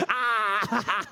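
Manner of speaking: long, drawn-out "ah"; mock cry. Comedian clowning for the crowd, long mock cry. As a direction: let out a long comic shout. Mimics the pose, amid laughter.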